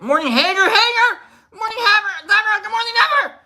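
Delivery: high, loud goofy hello; playful, over-the-top high-pitched welcome; excited greeting. Pretend. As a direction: squeal out the greetings in falsetto. Greeting viewers who just arrived.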